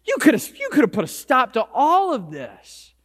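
level the blame at him, voice lifted; acted reproach, pushing the opening hard; accusing. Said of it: "they even accuse him".

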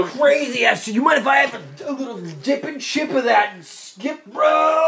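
high, loud, slow surfer-style bro; radical idiot. Acted. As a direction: shout it as a stoked radical dude. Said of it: "I speak fluent in radical idiot".

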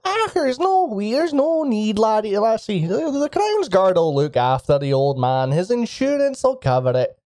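high, lightly voiced old lady with a Scottish lilt; old Scottish woman. Old woman brushing off the player's question, high and folksy. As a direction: reassure the lass like a wee granny. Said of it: "cock her head up to you and go".

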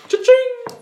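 high, loud cha-ching; cash register cha-ching. Pretend. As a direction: ring it out like a till. Sound effect cha-ching.

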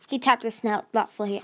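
ordinary delivery

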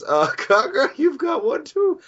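high-pitched, drawn-out voice; pointing it out. Retold or imitated. Friend telling the girl she has a mushroom too, high voice. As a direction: break it to her, high and slow. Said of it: "Shinpachi goes".

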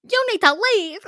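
high, loud mock-outraged cry; hammed-up scandalized shout; outraged audience. Audience jokingly ordering the student out, mock outrage. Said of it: "everyone in the audience is like".

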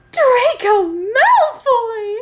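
very high, loud, drawn-out exclamation; dramatic reveal. Acted. Reader reveals who called Ebony, melodramatic cry. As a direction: announce the name in hammed-up amazement.

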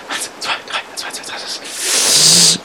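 voiceless muttered countdown; nervous whispered count. Acted. Man psyching himself up to jump, whispered count.

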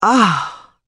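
high, rapt sigh of delight; rapture. A narrator's reading. Erdil marvels at the ruin with rapture. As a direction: breathe it out in awe. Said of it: "with genuine rapture".

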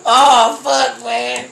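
breathless from laughing; gasping out of a laughing fit; after laughing hard. Real, not acted. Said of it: "Oh, my stomach hurt".

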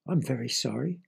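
usual speaking voice

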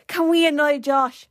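mock child asking a favour; little cousin's voice. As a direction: beg like an eager younger cousin. Younger cousin's quote voiced.

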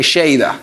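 Lleida with an initial "sh"; Argentine pronunciation. Retold or imitated. Guest quoting his own slip, Argentine sh sound. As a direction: say Lleida as "SHEY-da". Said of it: "Yo dije".

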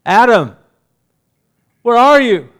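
loud, high calling out across the garden; God calling out to the hiding Adam. Acted. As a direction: call for Adam like God searching. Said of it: "God's voice came".

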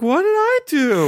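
"What did I do?" in a high, slow put-on voice; mock innocent. Cohost retells his reply to CJ, mock innocent. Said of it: "I was like".

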